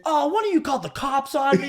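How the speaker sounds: high-pitched betrayed complaint; mock outrage. Gunman as the cops show up, mock outraged. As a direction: accuse them in a high voice.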